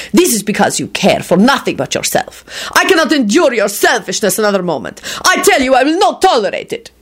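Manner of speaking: indignation climbing to high fury; wrathful. A narrator's reading. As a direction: build an angry tirade. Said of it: "he announced wrathfully".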